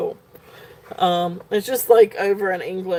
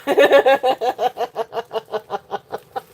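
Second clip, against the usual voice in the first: real laugh; 4 semitones higher than usual. Real, not acted. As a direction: burst of bright laughter. Reacts to a comment.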